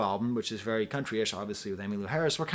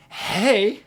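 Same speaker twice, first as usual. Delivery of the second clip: high, loud delighted shout; joyful yell of approval; excited cry; 9 semitones higher, 8 dB louder than usual. Real, not acted. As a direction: cheer out loud.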